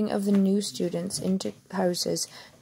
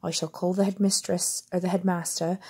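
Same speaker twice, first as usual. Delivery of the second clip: ordinary delivery, nothing marked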